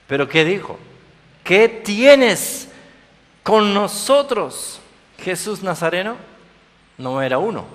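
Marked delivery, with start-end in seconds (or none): 1.4-6.2 high, loud, drawn-out demonic challenge. Acted. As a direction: snarl at Jesus as the unclean spirit. Unclean spirit speaks in scripture.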